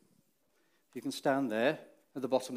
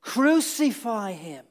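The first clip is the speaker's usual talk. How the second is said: retold crowd shout; 6 semitones higher, 4 dB louder, slower than usual. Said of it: "they all shouted".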